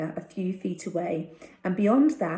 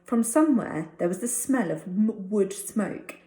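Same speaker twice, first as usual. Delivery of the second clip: usual speaking voice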